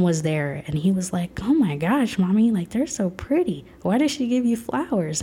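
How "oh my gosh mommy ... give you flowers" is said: lightly voiced little boy, higher and eager; a hint of a small boy gushing over flowers; son's excited voice. Mom voicing her son asking about the flowers, lightly childlike.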